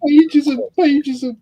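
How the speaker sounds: overwhelmed by decals, excited; excited exclaiming. Real, not acted. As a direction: marvel at the sheer amount. Sees pages of decals.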